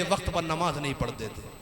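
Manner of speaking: low, soft close to a warning; solemn hush. Acted. Prophet's warning about late prayers, solemnly hushed. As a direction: drop right down in pitch and volume.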